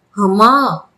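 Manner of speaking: a sudden acted cry of pain; mild mock scream of pain. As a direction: cry out as the log hits. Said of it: "Jayant அலறினான்".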